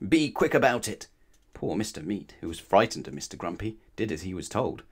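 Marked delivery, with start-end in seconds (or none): 0.1-1.1 higher, mildly cross hurry-up; snapped. A narrator's reading. Mister Grumpy hurrying the butcher, snapping. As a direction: snap impatiently. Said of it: "snapped Mister Grumpy".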